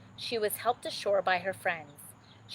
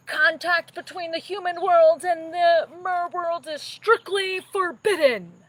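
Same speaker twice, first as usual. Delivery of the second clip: booming king's roar, much higher and louder, slowing on forbidden; furious shout; 10 semitones higher, 10 dB louder than usual. Acted. Angry sea king shouting at his daughter Ariel. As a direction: thunder the law down at your daughter. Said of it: "Triton shouted".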